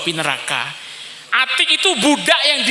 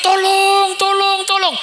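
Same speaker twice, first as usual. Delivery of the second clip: much higher, desperate scream; screaming for help; 10 semitones higher, slower than usual. Acted. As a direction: shriek for rescue at the gate of hell. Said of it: "Suara menjerit".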